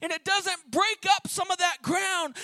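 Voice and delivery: climbing, loud, pleading sermon; fervent preacher crying out; preaching. Acted. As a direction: press it on the congregation's hearts. Preaching at full force.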